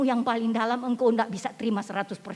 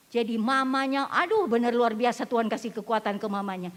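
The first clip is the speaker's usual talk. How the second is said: ordinary talk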